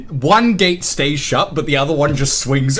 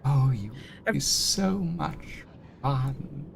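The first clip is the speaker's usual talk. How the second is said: soft, slow creature voice; relishing imp; 8 dB quieter, slower than usual. Acted. Imp sizes up the visitor, softly relishing. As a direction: draw it out softly with delight.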